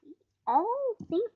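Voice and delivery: high toy crocodile voice; crocodile puppet voice. Acted. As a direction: squeak out the toy crocodile's answers.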